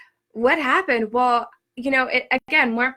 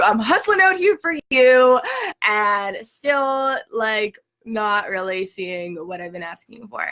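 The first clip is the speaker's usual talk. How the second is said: angry complaint; 2 semitones higher, 5 dB louder, slower than usual. Retold or imitated. Complaining to the universe about working hard, loud angry outburst. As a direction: push the grievance out hard, then let it drop. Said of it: "I was so mad".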